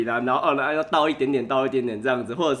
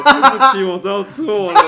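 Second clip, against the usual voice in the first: 笑着假装求饶；边笑边说的夸张道歉；笑出来的认错口气；半开玩笑地向拿刀的爸爸求饶，笑着说; 6 semitones higher, 9 dB louder than usual. Retold or imitated. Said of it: "然后那边哭".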